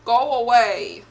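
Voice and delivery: loud, slow, drawn-out order; loud stretched shout; loud slow go away. Real, not acted. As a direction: drag it out and push him off. Fending off attacker.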